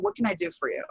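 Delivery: soft, high, caring ask; hushed, caring question to her best friend; gentle offer. Retold or imitated. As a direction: offer help tenderly to a crying friend. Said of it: "and I said".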